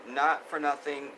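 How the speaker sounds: ordinary talk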